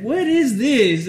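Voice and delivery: high, loud, slow demand; retold confrontation. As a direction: imitate an officer springing a discovery on you. Officer confronts him with cup.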